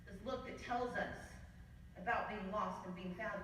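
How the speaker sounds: normal talking voice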